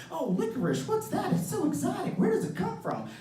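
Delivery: high, fascinated mock voice; gushing fake wonder at an exotic name; mock curious asker. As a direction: play the nosy stranger who finds the name exotic. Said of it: "They're just like".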